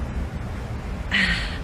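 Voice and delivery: long, soft breathy sigh. Real, not acted. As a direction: let out an overwhelmed sigh. Overwhelmed, stops mid-sentence.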